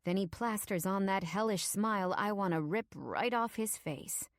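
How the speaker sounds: usual speaking voice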